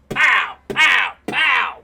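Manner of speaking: loud, high "pow" bursts; imitated gunshots. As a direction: shout out each gunshot separately. Imitates preacher firing a gun.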